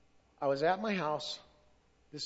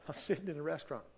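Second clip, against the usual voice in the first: laughing; 6 dB quieter, faster than usual. Real, not acted. Laughs while retelling.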